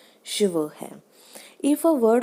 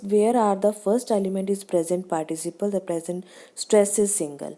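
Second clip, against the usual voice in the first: ordinary talk, nothing marked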